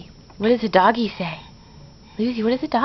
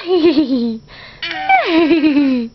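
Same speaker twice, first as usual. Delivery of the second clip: horse neigh; 5 semitones higher, 4 dB louder than usual. Shown as a demonstration. Parent showing baby horse sounds.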